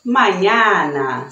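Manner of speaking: mañana said slowly with a clear Spanish ñ, ma-ÑA-na; slow model word for students, mañana with the ny sound; mañana stretched out slowly, ñ like Portuguese nh. Shown as a demonstration. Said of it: "Por exemplo".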